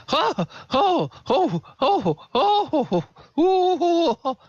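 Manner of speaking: very high, drawn-out "ho, ho"; hammed-up frightened wails, way up high; string out high, frantic hoots; panicked hooting. Frightened NPC wails as rat sounds follow.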